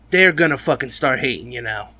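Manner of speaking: ordinary talk, nothing marked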